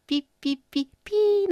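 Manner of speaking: short beeps then one long high beep; imitated time-signal beeps. Shown as a demonstration. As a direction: mimic the radio hour signal. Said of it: "の高さでちょっと高めに". (時報の高い音をまねて)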